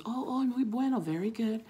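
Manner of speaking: high-pitched delighted quote; impressed approval. Retold or imitated. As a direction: imitate a pleased woman's praise. Retold woman's pleased reaction.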